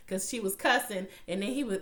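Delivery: usual speaking voice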